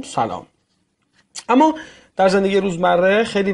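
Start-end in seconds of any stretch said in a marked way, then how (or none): 0.0-0.5 low, much softer falling سلام; soft falling salam. Shown as a demonstration. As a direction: let سلام drop low and quiet. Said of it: "این دو تا کلمه".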